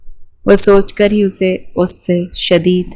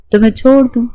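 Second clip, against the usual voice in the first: laughing; 6 semitones higher, faster than usual. A narrator's reading. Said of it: "وہ ہنس رہا تھا".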